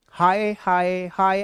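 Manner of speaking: varied style. Shown as a demonstration. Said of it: "अलग-अलग style से".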